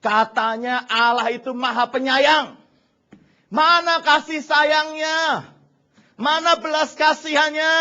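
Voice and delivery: rising into a loud, high protest; Abraham's growing complaint to God; complaining to God. Retold or imitated. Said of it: "Dia berpikir gini".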